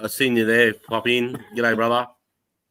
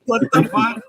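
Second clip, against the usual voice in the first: panicked mate; 13 semitones higher than usual. Retold or imitated. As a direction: squeal it like a spooked mate. Mate fleeing sees coppers.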